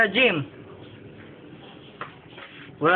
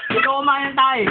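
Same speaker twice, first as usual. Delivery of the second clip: laughing taunt; 7 semitones higher than usual. Real, not acted. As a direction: retort broken up by laughter. Repeats taunt while laughing.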